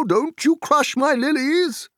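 put-on high, loud, hearty old rustic; cheerful booming country voice; jolly Tom Bombadil. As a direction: boom like a merry forest master.

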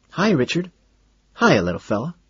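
high, swooping pet greeting; sing-song doggy talk. A narrator's reading. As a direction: coo hello to a little dog. Greeting and stroking the dog.

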